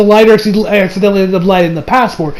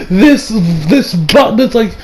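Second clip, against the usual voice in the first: ordinary talk, nothing marked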